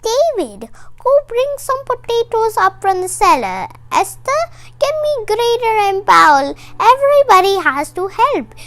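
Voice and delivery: put-on doting old granny offering latkes; grandmother voice. Booba Branya laughs and orders.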